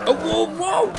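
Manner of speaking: very high, loud yelp; panicked yell. Real, not acted. Player attacked by the bear, panicked yell. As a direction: cry out high in alarm.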